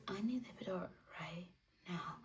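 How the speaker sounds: fast breathless whisper; desperate whisper. Retold or imitated. Begging nurse for epidural, fast whisper. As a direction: rush it out barely voiced.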